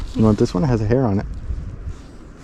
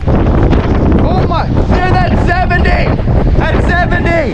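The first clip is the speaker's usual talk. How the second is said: loud, high, thrilled exclamations; excited shouting; 11 semitones higher, 3 dB louder than usual. Real, not acted. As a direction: shout in amazement over the wind. Seventy mile an hour wind.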